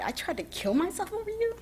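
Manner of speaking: high, laughing disbelief; incredulous laugh. Real, not acted. Thinking about the bullies she meets now, laughing in disbelief.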